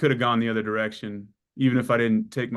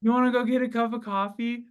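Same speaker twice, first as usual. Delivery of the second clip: mock sweet invite; 11 semitones higher than usual. Joking invitation to the nurse.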